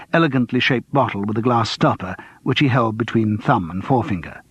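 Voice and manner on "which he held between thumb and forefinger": usual speaking voice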